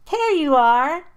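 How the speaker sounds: loud, high happy cry; happy exclaim. A narrator's reading. Greeting the dog running back, happy and loud. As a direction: greet the returning dog with joy. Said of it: "I exclaimed happily".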